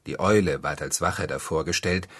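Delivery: usual speaking voice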